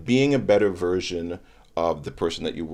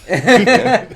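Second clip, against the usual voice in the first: laughing; 11 dB louder than usual. Real, not acted. Laughing at friends' progress.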